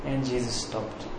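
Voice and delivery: very soft, low and slow; hushed repetition. A narrator's reading. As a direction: drop right down for a hushed echo. Hushed repeat for dramatic effect.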